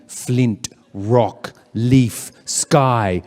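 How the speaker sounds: ordinary talk, nothing marked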